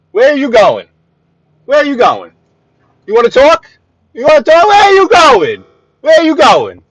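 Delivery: furious driver yelling through the windshield; angry yelling. Real, not acted.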